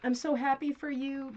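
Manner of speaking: loud, high and delighted; excited high greeting. Real, not acted. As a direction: greet him like a proud parent. Greeting son on camera.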